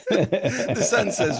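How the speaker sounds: fighting back laughter as he reads; wheezing through a chuckle; laughing. Real, not acted. Teller still laughing.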